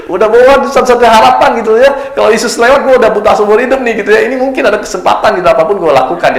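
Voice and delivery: high, loud, pressing desperation; desperate urgency. Retold or imitated. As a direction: speak as a man with one last chance. Preacher voicing the desperate blind man. (suara putus asa yang mendesak, tinggi dan keras)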